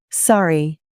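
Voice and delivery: plain reading voice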